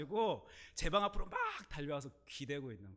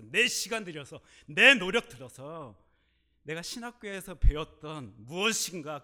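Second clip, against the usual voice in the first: rising louder and higher list; preacher's crescendo; building preaching; 4 semitones higher, 9 dB louder than usual. Real, not acted. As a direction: build each phrase bigger. Building sermon rhetoric.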